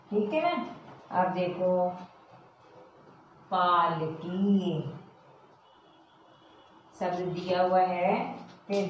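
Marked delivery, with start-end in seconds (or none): 3.5-4.8 पालकी drawn out; slow model. Shown as a demonstration. Teacher modelling पालकी for pupils. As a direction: say पालकी very slowly. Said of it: "modelling the word".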